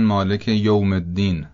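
یوم said "yowm", in a Persian accent; Persian-accented یوم with an o vowel. Shown as a demonstration.

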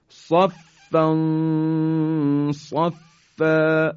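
صفا recited slowly with a nasal hum before ص; ikhfa recitation. Shown as a demonstration. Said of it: "به این مثال توجه بفرمایید". (تلاوت آهسته صفا)

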